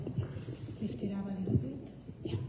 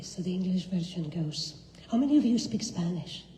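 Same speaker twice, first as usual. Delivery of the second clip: ordinary talk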